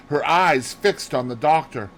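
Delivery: plain reading voice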